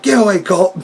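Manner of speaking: high-pitched imitation of an irritated wrestler; mock angry yell. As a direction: shove him off with a mock yell. Retelling Jericho shoving Cabana away.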